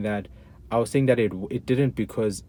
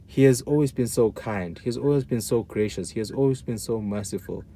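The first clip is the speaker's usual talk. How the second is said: ordinary delivery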